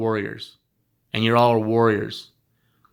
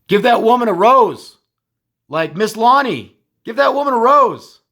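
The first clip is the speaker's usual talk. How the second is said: much higher, loud rallying call; 9 semitones higher, 6 dB louder than usual. Real, not acted. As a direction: shout it to the whole room. Calling for roses for Shannon.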